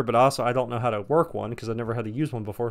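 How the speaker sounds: ordinary delivery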